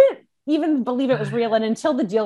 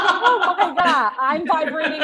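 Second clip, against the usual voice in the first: slow, laughing, higher; giddy disbelief; 6 semitones higher, slower than usual. Retold or imitated. Host quoting herself after Brooke's praise, slow giddy laugh. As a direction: laugh through the words, stunned. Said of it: "I'm like".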